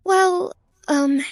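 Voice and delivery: high, hesitant stalling; flustered. Acted. Woman caught out by the prince, flustered. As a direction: fumble for an excuse. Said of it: "In my flustered state".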